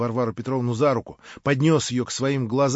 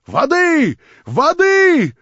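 резкий высокий громкий зов; позови на помощь в панике; испуганный крик; 11 dB louder, much slower than usual. Acted. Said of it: "Раздался её испуганный крик". (испуганный крик «Воды!»)

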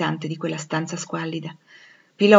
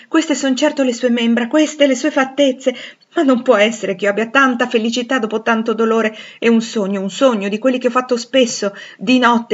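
raised, impassioned outpouring; rapturous disbelief; 4 semitones higher, 6 dB louder than usual. Acted. Rochester embracing Jane and fearing a dream, rapturous disbelief. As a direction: pour it out fervently, hardly believing it.

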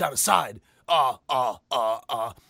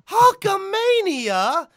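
Hulkamania stretched out slow, much higher and louder with a laugh; drawn-out Hulkamania; 13 semitones higher, 6 dB louder, much slower than usual. Retold or imitated. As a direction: sing out Hulkamania mockingly. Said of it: "my name is Paul Heyman".

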